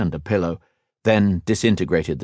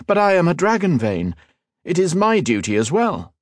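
raised, earnest and insistent; earnest elf lord; 11 semitones higher than usual. Acted. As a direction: press his duty, proudly. Liniel insists.

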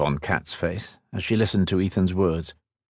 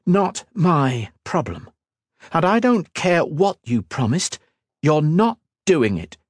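high, emphatic defiance; angry refusal; 8 semitones higher than usual. A narrator's reading. Woman forbids her fiancé's promise, angry and defiant. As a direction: lay down the law angrily.

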